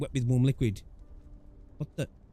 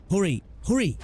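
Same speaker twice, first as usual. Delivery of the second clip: urgent hurry; 7 semitones higher than usual. Acted. Urgent calls.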